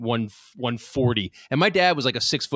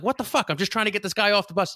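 indignant dad; 8 semitones higher, faster than usual. Retold or imitated. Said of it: "And my dad goes like".

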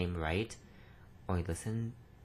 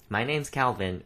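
louder, insisting on Calvin with a clear v; firm, louder correction: Calvin; stress on Calvin; 3 semitones higher, 6 dB louder than usual. Real, not acted. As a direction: set the record straight on Calvin. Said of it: "It's not Califin".

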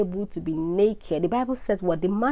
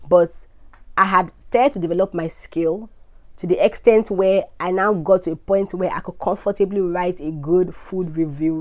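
usual speaking voice

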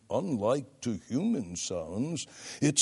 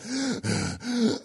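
sharp demonstrative gasp for air, pitched high; mock breathless gasp; 10 semitones higher than usual. Shown as a demonstration. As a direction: imitate a breathless preacher gulping air. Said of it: "creates pity for the breathless orator".

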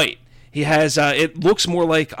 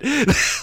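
burst of laughter; 10 semitones higher than usual. Real, not acted. Laugh after Oh shit.

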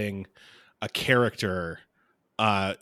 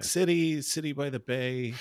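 lightly voiced noir narrator; a hint of a hardboiled detective; 4 semitones higher, 3 dB quieter than usual. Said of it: "Very, very Chuck Palahniuk".

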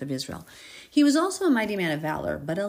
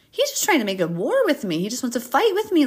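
higher and louder, worked up; agitated paraphrase; 8 semitones higher, 6 dB louder than usual. Retold or imitated. Host retelling the king's fear of war. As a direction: blurt it out like he's sure of a trap.

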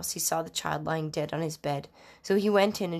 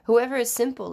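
calling to passers-by; 6 semitones higher, 6 dB louder than usual. A narrator's reading. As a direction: read as a restrained call, higher and louder. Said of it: "calling to those who pass by".

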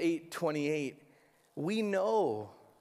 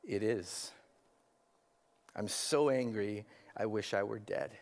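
lightly voiced sullen Jonah, lower and softer; hardened Jonah; 8 semitones lower, 4 dB quieter than usual. Jonah answering God, a hint of bitter sulk. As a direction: mutter it like a bitter sulker. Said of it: "the hardened Jonah responds".

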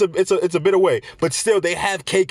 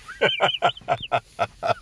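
very high wheezy laugh whistle; whistling laugh; 3 dB quieter than usual. Real, not acted. Laughing at his own joke, wheezy whistling giggle. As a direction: laugh in a thin whistle like a smoker. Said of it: "That little laugh whistle".

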